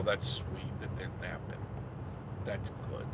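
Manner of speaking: whispered, tender and soft. Real, not acted. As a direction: breathe it softly, touched. Tender reaction to napping baby.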